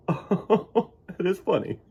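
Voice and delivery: slow laughing high chuckle; storyteller giggling at the picture. Acted. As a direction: savor the joke through a laugh. Laugh heard on line.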